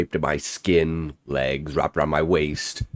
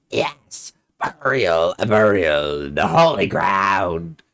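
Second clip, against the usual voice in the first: put-on rasping ghost-child voice; guttural rasp; 2 semitones higher, 3 dB louder than usual. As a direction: rasp it like a grave creature. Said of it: "that guttural rasp".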